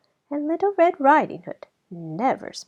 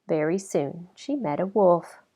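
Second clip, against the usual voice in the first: plain reading voice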